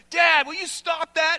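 loud, high mock scolding; exasperated family. Family telling Dad to stop, mock exasperated. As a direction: imitate embarrassed family calling Dad out.

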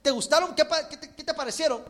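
eager son. Acted. Son asks mother in joke.

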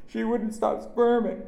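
high, slow, loud wail; sobbing. A narrator's reading. Little girl excusing herself to cousin, sobbing. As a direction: cry it out, drawn out and high. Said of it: "she sobbed".

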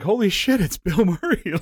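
like you can't believe he's at your door; mock starstruck shock. Musicians spotting Bill Murray at the door, starstruck and laughing.

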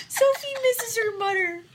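high pet voice through laughter; mock pet voice, giggling; Sophie voice, laughing. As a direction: coo it high while cracking up.

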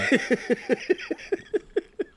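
long high-pitched giggle; high laugh. Real, not acted. Laughs after password slip.

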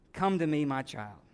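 soft, low, fatherly call; tender invitation. Acted. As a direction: draw the child close gently. Jesus voiced tenderly.

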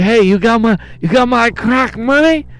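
high, twitchy strung-out hustler; crackhead pimp voice. Retold or imitated. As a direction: beg for crack money like a jittery addict. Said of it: "You sound like a crackhead pimp".

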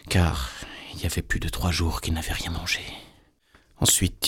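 usual speaking voice

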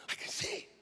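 barely voiced, whispered in wonder; awed whisper. Healed father walking and looking, awed whisper. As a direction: whisper it as if scarcely believing.

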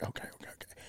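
breathless hushed okays; whispering. Retold or imitated. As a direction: whisper it, nerves tight. Whispering as elk steps out.

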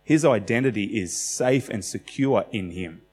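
ordinary delivery

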